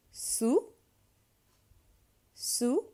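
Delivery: "Sous" as soo, silent final s; oo vowel demo. Shown as a demonstration. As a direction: model "Sous" with a high, loud oo. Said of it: "oo sound".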